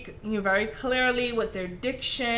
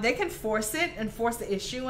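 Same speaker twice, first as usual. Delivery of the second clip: ordinary delivery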